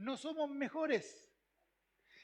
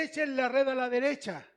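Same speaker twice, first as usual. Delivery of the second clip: calling out; 3 semitones higher, 9 dB louder than usual. Acted. Jesus calls to the fishermen.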